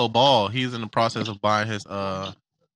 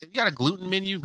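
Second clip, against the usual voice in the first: higher, softer mock customer voice; 8 semitones higher, 5 dB quieter than usual. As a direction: put on a high, quiet customer asking.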